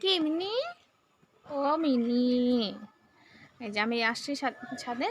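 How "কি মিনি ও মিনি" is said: high sing-song call to a cat; coaxing pet call. Real, not acted. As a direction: call the cat, pitch up and drawn out. Calling out to the cat Mini.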